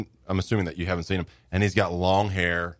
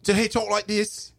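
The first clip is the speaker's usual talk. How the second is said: high-pitched mock British voice; British accent; 13 semitones higher than usual. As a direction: put on a squeaky British accent. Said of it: "That's a pretty good British accent".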